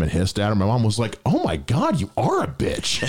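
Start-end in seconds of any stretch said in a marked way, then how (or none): 1.2-3.0 high, drawn-out mock outrage; imitated shocked mom. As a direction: play mom appalled at the cat. Acts mom's shock at cat.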